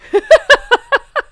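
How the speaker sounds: high, loud cackling giggle; helpless laugh. Real, not acted. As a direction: burst out in rapid high laughter. Laughs after missing the star.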